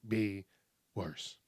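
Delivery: quiet self-reminder; soft echo. Shown as a demonstration. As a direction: say it again under your breath. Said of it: "Always think of it that way".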